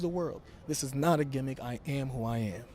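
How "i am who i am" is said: ordinary talk, nothing marked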